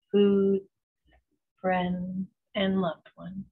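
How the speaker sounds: slow, even meditation voice with long pauses; unhurried, soothing visualization, letting each phrase settle; guided meditation. Acted. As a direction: guide them gently into calm, phrase by phrase.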